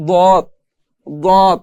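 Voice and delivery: দত said with the tongue edge on the side teeth; tongue-side letter. Shown as a demonstration. Teacher demonstrates the letter দত to students. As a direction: press দত out against the side teeth. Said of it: "লাগিয়ে পড়তে হয়".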